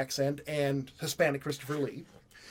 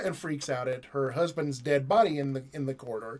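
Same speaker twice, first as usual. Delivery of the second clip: usual speaking voice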